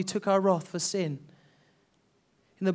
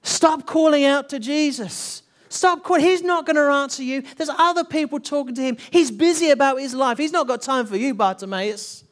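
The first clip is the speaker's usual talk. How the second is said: loud, higher scolding voice; scolding crowd; 5 semitones higher, 3 dB louder than usual. Retold or imitated. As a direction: tell the beggar off, impatient and dismissive. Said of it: "many voices that were calling out to him".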